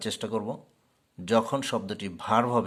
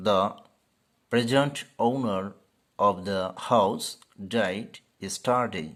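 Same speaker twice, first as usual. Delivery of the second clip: each word slow and clearly separated; very slow teacher read; slow example sentence; 3 dB louder, much slower than usual. Shown as a demonstration. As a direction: say "present owner of the house died yesterday" slowly, stressing PREsent.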